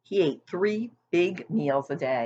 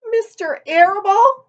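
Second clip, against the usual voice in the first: much higher, louder tearful cry; sobbing disbelief; 10 dB louder than usual. A narrator's reading. Wilbur sobbing to the sheep, much higher and louder. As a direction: wail the name in shock. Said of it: "sobbed Wilbur".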